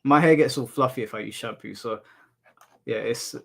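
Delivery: ordinary talk, nothing marked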